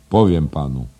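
solemn. A narrator's reading. Headmaster about to reveal an Englishman's boast, mildly solemn. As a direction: announce it with some ceremony. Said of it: "powiedział uroczyście".

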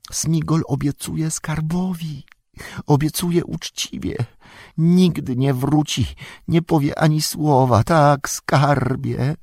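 high, slow, pitiful creature voice; Gollum's voice. A narrator's reading. Bound Gollum pleads innocence to Faramir, high and pitiful. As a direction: whine it like a wretched captured creature.

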